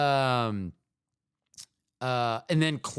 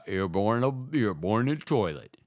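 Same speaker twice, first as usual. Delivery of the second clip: movie quote voice; 4 semitones lower than usual. Retold or imitated. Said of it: "hold on".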